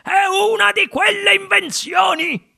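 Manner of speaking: high, enthusiastic outburst; elated grandpa crying out; thrilled exclamation. A narrator's reading. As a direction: proclaim it in sheer excitement. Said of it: "esclamò nonno Joe".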